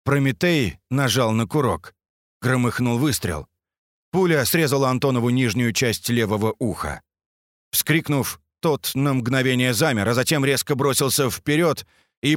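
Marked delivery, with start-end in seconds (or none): none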